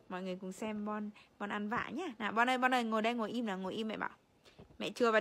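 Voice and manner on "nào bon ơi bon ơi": ordinary talk, nothing marked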